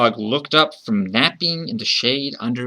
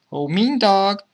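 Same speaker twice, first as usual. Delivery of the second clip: put-on high child's voice hailing a dog; light, high-pitched call; 9 semitones higher than usual. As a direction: call out high like a little kid. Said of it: "the child called out".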